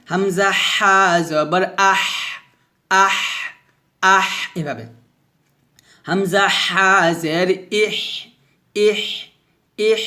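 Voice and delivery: slow spelling with a strong mid-throat h in আহ, ইহ; long rasped h closing আহ and ইহ; breathy hams. Shown as a demonstration. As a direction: push thin air from the throat after আহ, ইহ. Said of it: "মাঝখান থেকে একটা শব্দ বের".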